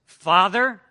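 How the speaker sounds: loud rising "Father?"; loud pleading call. Acted. Son rehearsing formal plea.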